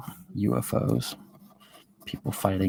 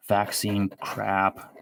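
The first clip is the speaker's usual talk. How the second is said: ordinary talk, nothing marked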